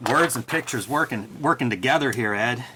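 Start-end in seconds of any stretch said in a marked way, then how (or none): none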